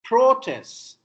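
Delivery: "Protest" as PRO-test, high loud PRO; noun stress. Shown as a demonstration. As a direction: punch the first syllable of "Protest". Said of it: "is noun".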